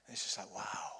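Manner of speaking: awed whisper; whispered in wonder at the memory; hushed wow. Real, not acted. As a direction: breathe it out in quiet awe. Awed pause in prison story.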